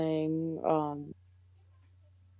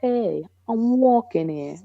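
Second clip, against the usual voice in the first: put-on slow, loud, high Boston tough-guy accent; 6 semitones higher, 6 dB louder, slower than usual. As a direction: belt it out like a Boston cabbie. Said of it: "almost like the Boston, like, voice".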